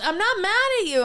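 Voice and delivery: high, loud mock protest; heated denial. Customer to server over steak knife, heated denial. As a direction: imitate a touchy customer insisting he is calm.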